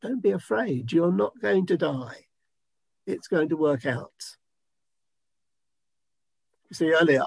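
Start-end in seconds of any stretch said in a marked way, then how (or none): 0.0-4.4 calm him down gently; hushed soothing words of peace; gentle reassurance. Retold or imitated. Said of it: "God saying to you".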